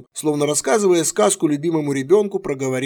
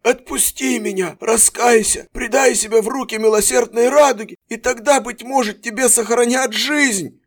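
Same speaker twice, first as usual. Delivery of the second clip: fervent plea; 9 semitones higher than usual. A narrator's reading. Prisoner pleads high.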